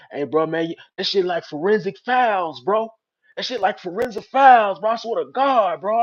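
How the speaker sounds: loud, high, hyped-up retelling; excited friend. Retold or imitated. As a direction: act out a buddy raving about it. Acting out excited friend calling from prison.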